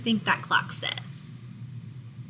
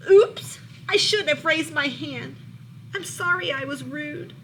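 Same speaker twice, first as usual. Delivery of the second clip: lively, louder storybook read-aloud; reading to children; 4 semitones higher, 6 dB louder than usual. A narrator's reading. Teacher reading a monster book to her class, lively storybook voice. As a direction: bring the picture book alive for the class.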